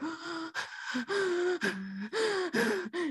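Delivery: sharp, high excited gasp; a stunned fangirl gasp, breathy and high. Retold or imitated. Fan finds the crush waiting in her room, high gasp.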